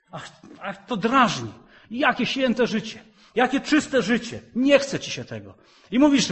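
loud, high, fast fiery preaching; preaching at full force. Acted. Preacher rousing the church to holy living, fiery and loud. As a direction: thunder it at the congregation.

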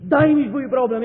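fervent shout of daj mi twój problem; loud preaching. Acted. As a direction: call out the offer with full force. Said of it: "musimy uczyć mówić".

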